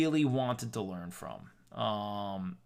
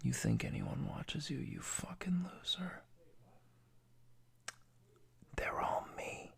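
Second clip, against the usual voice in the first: hushed, menacing villain gloating; whispered villain taunt; 4 semitones higher, 10 dB quieter than usual. Acted. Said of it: "He told me".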